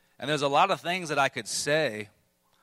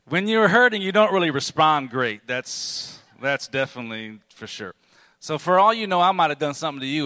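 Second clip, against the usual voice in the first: higher, louder preacher's cry; forceful preaching; 4 semitones higher, 10 dB louder than usual. Acted. Pastor pleading with the congregation, loud and high. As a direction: drive the point home to the room.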